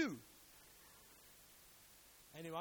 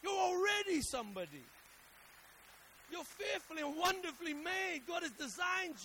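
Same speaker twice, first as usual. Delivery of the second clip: loud and high, building on already; pounding home that they're already somebody; rising preaching shout; 10 semitones higher, 8 dB louder than usual. Real, not acted. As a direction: preach it full force, climbing.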